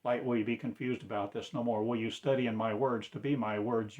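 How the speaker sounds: ordinary delivery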